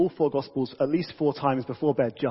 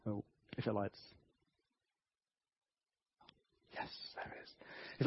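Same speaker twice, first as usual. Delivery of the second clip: hushed; 6 semitones lower, 17 dB quieter than usual. Real, not acted. Watching the candle relight.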